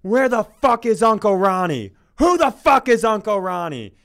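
bellowing, raging at the whole bar; furious shout. Pretend. Friend bursting into a bar, shouting in fury. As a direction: roar it out across a crowded bar. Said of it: "And you shout".

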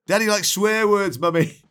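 put-on high child telling on daddy; tattling child.

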